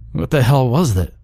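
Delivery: alarmed cry. A narrator's reading. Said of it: "Jake cried".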